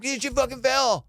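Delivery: high, exasperated insistence; frustrated. Retold or imitated. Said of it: "I'm getting frustrated".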